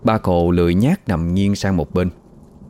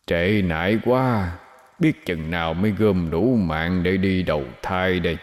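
muttering ghost whisper; 5 dB quieter than usual. Acted. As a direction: mutter to yourself like a lurking ghost. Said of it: "tự lẩm bẩm".

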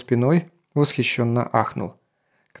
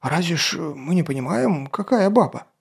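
timid guard; 4 semitones higher than usual. Acted. Guard excuses himself.

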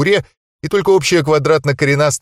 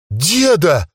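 stunned exclamation; 5 semitones higher than usual. Acted. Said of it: "воскликнул ошеломлённый Игельшнойцхен".

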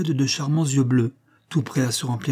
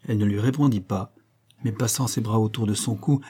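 usual speaking voice

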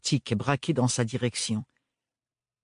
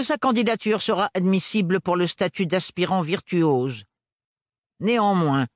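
stony resonant voice; 8 semitones higher than usual. A narrator's reading. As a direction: speak like living marble. Hélène rules. (voix minérale et résonnante)

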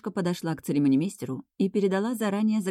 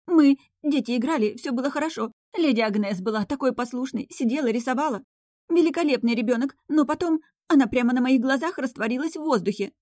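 much higher, louder frantic rambling; panicked governess; 8 semitones higher, 4 dB louder than usual. Acted. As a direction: blurt it all out in a panic. (испуганная гувернантка, сильно выше и громче)

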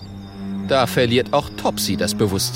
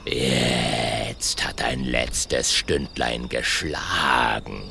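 low, slow growling villain; menacing dinosaur; 12 semitones lower, 3 dB quieter, much slower than usual. Acted. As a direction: relish the threat, deep and slow. T-rex villain voice.

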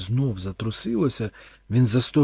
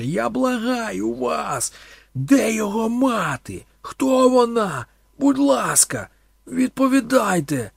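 loud, much higher, slow begging; desperate pleading; 11 semitones higher, 7 dB louder, slower than usual. A narrator's reading. Thorn begs the priest for the mother, desperately. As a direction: beg him for the answer, desperately.

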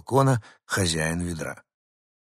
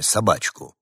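usual speaking voice

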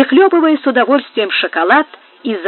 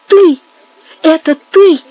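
high, loud cry of horror; horrified cry; 7 semitones higher, 8 dB louder than usual. A narrator's reading. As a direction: burst out in shocked recognition. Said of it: "вскричала она с ужасом".

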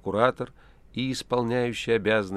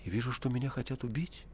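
anxious voice; 4 semitones higher, 5 dB quieter than usual. A narrator's reading. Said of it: "и голос его стал тревожен".

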